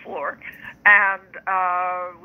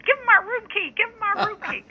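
yelling. Retold or imitated. Sister yelling to give [name] the room key, high mock yell. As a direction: shriek it at your sister's side. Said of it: "my sister was yelling".

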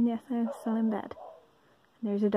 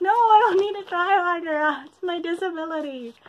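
higher and louder, a laughing protest; indignant retort; 7 semitones higher, 5 dB louder than usual. Retold or imitated. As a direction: snap back that it's not your fault. Pushing back at tutor's verdict.

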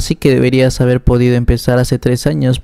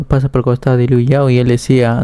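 plain reading voice

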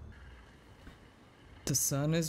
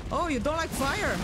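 high mocking taunt again; 10 semitones higher than usual. Real, not acted. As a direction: tease it, sing-song. Repeating the taunt.

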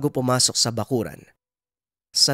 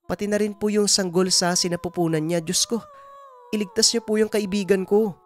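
higher-pitched woman begging for help; Nanay Dolor, anxious pleading; 10 semitones higher than usual. Acted. Woman begs healer to save her pregnant friend, pleading. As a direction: plead urgently for your friend's life.

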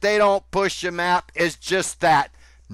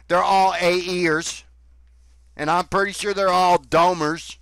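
usual speaking voice

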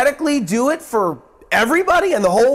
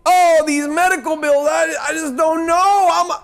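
higher, fretting and hurried; lightly acted panic over the bills; mock worried; 8 semitones higher than usual. Voicing a worried patient.

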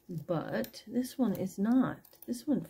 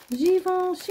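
much higher and louder, thrilled brand name; excited exclaim; 11 semitones higher, 7 dB louder than usual. Real, not acted. Seller thrilled to find a designer piece, excited. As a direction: squeal the name like a prize find.

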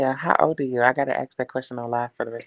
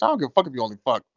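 ordinary talk

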